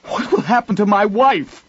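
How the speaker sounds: high, pained plea; desperate husband's high cry; anguished. A narrator's reading. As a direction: beg for an answer in anguish. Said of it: "Tarvi demanded in an anguished voice".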